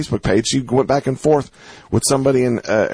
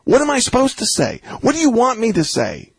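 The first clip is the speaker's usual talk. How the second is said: fired up; 13 semitones higher than usual. Real, not acted. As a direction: push it hard like pleading with a friend. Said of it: "this is how I talk to my friends".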